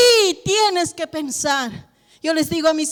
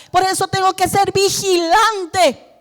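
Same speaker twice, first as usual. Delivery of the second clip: ordinary talk, nothing marked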